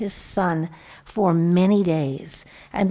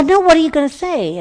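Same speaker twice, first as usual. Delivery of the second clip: exasperated retort; 8 semitones higher, 7 dB louder, faster than usual. Retold or imitated. Son's exasperated retort in anecdote.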